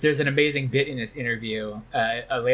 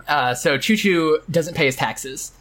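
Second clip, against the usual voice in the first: usual speaking voice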